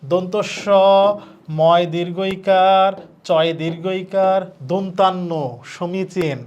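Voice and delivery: slow letter-by-letter spelling aloud; teacher's slow sounding-out, word said again at the end. Shown as a demonstration. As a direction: drag out each letter name for the class to copy. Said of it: "তুমি পড়বা সমীচীন".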